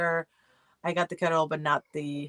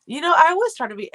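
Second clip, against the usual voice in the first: emphatic; 10 semitones higher, 7 dB louder, faster than usual. Real, not acted. Emphatic start of point.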